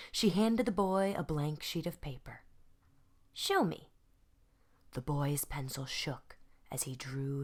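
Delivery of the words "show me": coaxing. Acted. Vashti coaxes boy.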